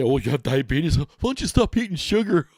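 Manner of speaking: loud mock voice climbing very high; jeering impression of a clueless advice-giver; mocking know-it-all. Vlogger mimics people who say stop eating sugar, mocking.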